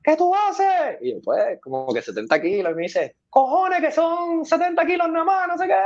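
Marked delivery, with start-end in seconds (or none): 0.0-1.0 retold yell. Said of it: "Emilio grita". 3.3-5.8 imitated angry yell. Coach yells at lifter.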